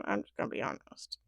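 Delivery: whispered confession, voice dropped away; whispered aside. Real, not acted. Whispering a guilty aside to viewers about the couple. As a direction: lean in and whisper it like a guilty secret.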